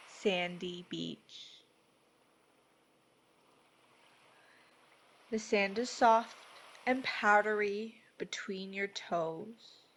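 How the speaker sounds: measured, soothing delivery, each phrase given room; guided meditation. Acted. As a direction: guide them gently into peace, unhurried.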